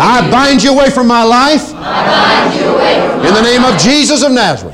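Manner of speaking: bold shout. Shown as a demonstration. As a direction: shout it with full authority. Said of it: "Say it out loud, please, boldly".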